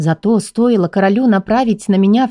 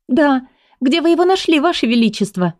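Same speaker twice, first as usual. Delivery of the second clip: put-on bright young woman; 7 semitones higher than usual. A narrator's reading. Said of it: "спросила я".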